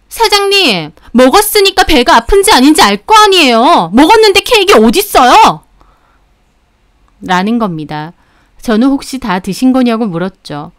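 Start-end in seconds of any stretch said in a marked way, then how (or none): 0.1-5.6 high, loud, piercing outburst; shrill angry yelling. Acted. Angry customer yelling at the cake shop owner, shrill and high. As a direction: yell sharply in a raised pitch. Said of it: "엄청 앙칼지게 소리를 지르면서".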